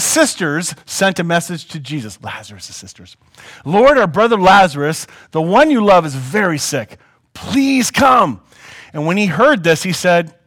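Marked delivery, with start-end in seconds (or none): 3.6-8.4 loud, raised plea for help; plead, rising high on the last words; urgent pleading. Acted. Sisters sending Jesus word of Lazarus, urgent pleading.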